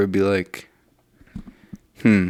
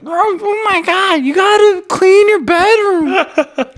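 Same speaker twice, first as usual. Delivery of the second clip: very high-pitched, drawn-out whining; slow, wailing small-child impression; whiny little boy; much slower than usual. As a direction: whine it way up high, dragging the words. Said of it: "doing a whiny little".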